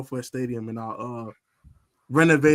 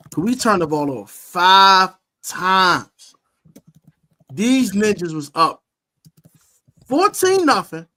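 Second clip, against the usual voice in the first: rising, louder, slower climb of the score; excited buildup; 4 semitones higher, 7 dB louder than usual. Real, not acted. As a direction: build the hype number by number. Excited retelling of comeback.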